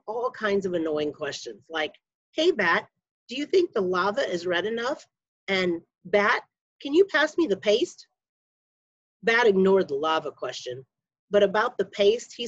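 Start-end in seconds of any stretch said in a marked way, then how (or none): none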